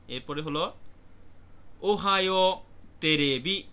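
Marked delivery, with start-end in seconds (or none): 1.8-3.6 Ohio তেরেবি said slowly and louder, each syllable laid out; careful model pronunciation. Shown as a demonstration. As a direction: spell out Ohio তেরেবি slowly and clearly for the class. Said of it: "একটি টেলিভিশনের কাল্পনিক নাম". (ধীরে, জোরে, স্পষ্ট উচ্চারণে Ohio তেরেবি)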